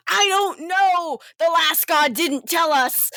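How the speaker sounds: high, exasperated outburst; flustered protest. Acted. River protests to everyone staring.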